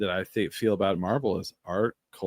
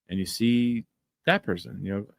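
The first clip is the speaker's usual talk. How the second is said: ordinary delivery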